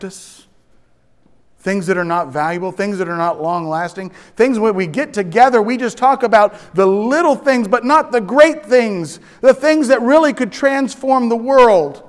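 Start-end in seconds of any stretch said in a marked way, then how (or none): 4.4-12.0 loud, high sermon voice; fervent preaching. Acted. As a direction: drive the point home. Sermon build-up.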